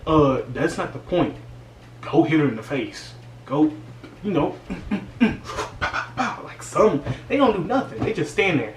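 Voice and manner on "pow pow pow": mock punching noises; punch sound effects. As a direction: pow pow like a cartoon fight. Miming punches.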